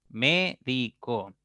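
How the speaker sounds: "Médico" slowly as mé - di - co; syllable by syllable. Shown as a demonstration. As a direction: break "Médico" into three slow beats, mé, di, co. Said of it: "pueden descomponerse en tres sílabas".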